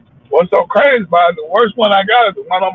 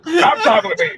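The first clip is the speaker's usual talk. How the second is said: mock plea; 11 semitones higher than usual. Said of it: "That's what I want you to do".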